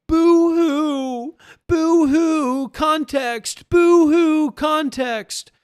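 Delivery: very high, loud mock boo-hoo wail; mocking crybaby wail. Mocking whiners about context, loud high wail.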